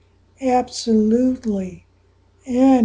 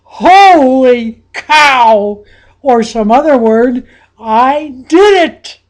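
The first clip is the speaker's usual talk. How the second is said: loud, higher, stretched holy cow; ecstatic exclamation; 4 semitones higher, 9 dB louder, slower than usual. Acted. Coach voicing the player's inner joy, loud ecstatic. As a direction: shout out stunned triumph. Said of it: "electricity ... that goes".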